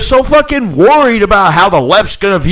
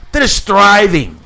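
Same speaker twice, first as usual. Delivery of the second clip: normal talking voice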